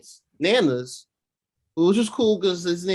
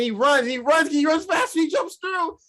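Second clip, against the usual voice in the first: much higher, breathless excited retelling; thrilled narration; 12 semitones higher, 3 dB louder than usual. Real, not acted. Fan retelling the portal jump to co-hosts, much higher excited. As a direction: relive the big moment, buzzing.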